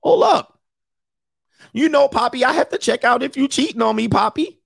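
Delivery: put-on sing-song like a doting girlfriend; cute girlfriend voice. Girlfriend sweetly checking up on her man, high. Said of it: "You be like".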